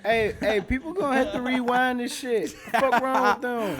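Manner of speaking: loud voice clipping the mic; yelling hot into a distorting mic; clipping distortion. Real, not acted. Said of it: "Sorry for all the distortion".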